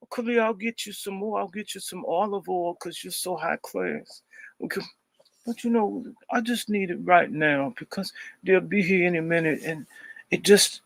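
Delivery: high, lilting camp voice, teasing her; mock campy voice. Continuing the teasing prank call.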